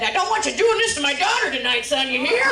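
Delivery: loud mock fatherly warning; imitated dad laying down the law; stern dad. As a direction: bellow it as a protective father. Dad warning her prom date.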